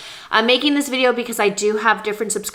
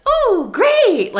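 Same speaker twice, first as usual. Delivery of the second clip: high, loud cooking-show gush; fake enthusiasm; 5 dB louder than usual. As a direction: gush over the dish like a TV host. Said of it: "not that I'm gonna, like, fake it".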